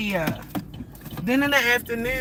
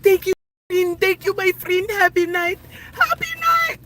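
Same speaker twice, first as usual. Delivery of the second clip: much higher, sing-song thank-you voice; mock his grateful high-pitched thanks; imitating the man; 10 semitones higher than usual. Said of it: "And he was like".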